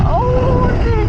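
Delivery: high, loud surprised yelp; startled yelp. Real, not acted. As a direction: yelp as the wheel slips. Bike slips in snow.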